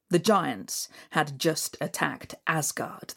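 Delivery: ordinary delivery, nothing marked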